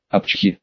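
small soft sneeze; a quick tiny sneeze. A narrator's reading. Parrot sneezes.

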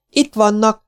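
put-on very high, loud boy's shout; boy's shriek. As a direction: point them out with a yelp. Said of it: "rikkantotta Domi". (éles, magas gyerekrikkantás)

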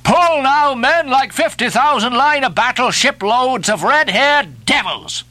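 high, driving exhortation to rowers; urgent harangue, building to a high demand; rallying cry. A narrator's reading. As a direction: goad the crew into pulling. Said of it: "cried Stubb".

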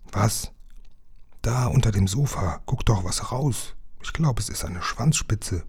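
soft, secretive discovery; hushed. A narrator's reading. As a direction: keep it down near the monster. Stealthy exchange beside hidden monster. (mit gedämpfter Stimme)

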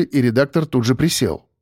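plain reading voice

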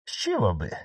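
usual speaking voice

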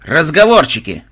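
loud commanding bark; sharp, loud rebuke. A narrator's reading. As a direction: snap it out like a sergeant. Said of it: "рявкнул Бонзай".

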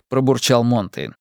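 usual speaking voice

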